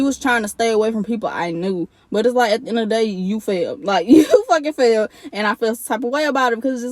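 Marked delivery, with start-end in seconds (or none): 4.1-5.1 a laugh, then a louder, higher jab. Real, not acted. To the listeners about her ex, a louder laughing jab. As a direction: laugh at him and land the insult. Mocking the ex's failure.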